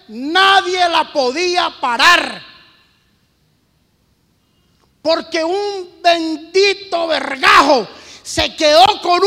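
loud, driving sermon delivery; preaching at full force. Acted. Preacher warning the congregation about ruin, forceful preaching. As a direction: hammer the warning home to the listeners.

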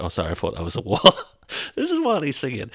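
giggling through a mock apology; laughing retelling. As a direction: quote broken up by laughter. Cracks up retelling the fall.

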